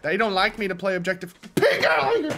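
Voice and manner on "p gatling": high, loud yell; sudden high-pitched outburst; exasperated shout. Real, not acted. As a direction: yell the enemy's name in outrage. Cursing the enemy.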